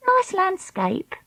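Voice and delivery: put-on high, slow girl's voice; Treacle voice. Treacle's voice in the story.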